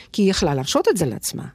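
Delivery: ordinary talk, nothing marked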